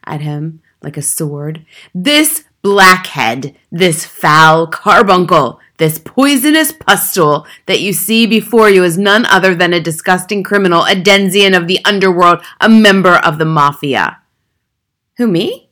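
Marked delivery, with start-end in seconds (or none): 1.9-14.2 loud, contemptuous string of insults; booming tirade. A narrator's reading. Headmistress denounces the boy before assembly, booming. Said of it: "boomed the headmistress". 15.2-15.7 high, startled question; puzzled. A narrator's reading. Accused boy answers the headmistress, genuinely puzzled. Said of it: "looked genuinely puzzled".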